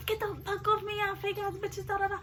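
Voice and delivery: high, soft mimicry of resistance; hushed mock tantrum; mock protesting roommate. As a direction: mimic a friend fighting you off. Imitating roommate being dragged.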